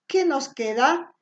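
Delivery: ordinary talk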